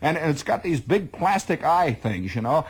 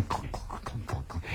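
whispered, low clunk clunk of boots; soft clunking; 12 semitones lower, 13 dB quieter than usual. Retold or imitated. Soldier mimics his own heavy steps, quietly clunking. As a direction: tap out the dull marching beat under your breath. Marching rhythm of the gear.

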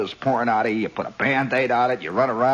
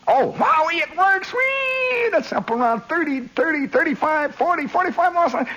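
very high, excited whoops; gleeful shout. Real, not acted. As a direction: squeal with joy as the needle climbs. Thrilled when speedometer works.

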